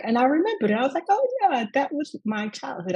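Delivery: light up as the memory comes back; delighted recall. Retold or imitated. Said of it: "she was like".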